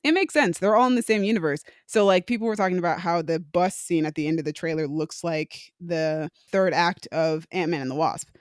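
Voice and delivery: ordinary delivery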